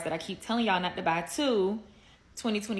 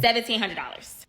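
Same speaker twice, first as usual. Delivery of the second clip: loud; 3 semitones higher, 8 dB louder than usual. Real, not acted. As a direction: blurt out the price. Repeating the price loudly.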